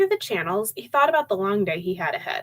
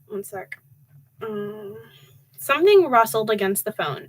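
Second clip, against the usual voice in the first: ordinary delivery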